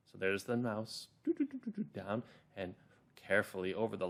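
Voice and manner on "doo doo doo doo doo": tiny high-pitched pitter-patter noises; high scurrying sound. Shown as a demonstration. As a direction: imitate little mouse feet with high, light syllables. Imitates the mouse scurrying along.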